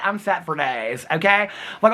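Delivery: ordinary talk, nothing marked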